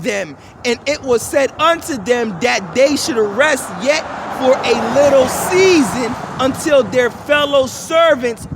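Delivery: slow, emphatic Bible reading; scripture reading. Acted. Preacher reading verse eleven, slow emphatic scripture reading. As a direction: stretch and stress the verse's key words. Reads scripture aloud to listeners.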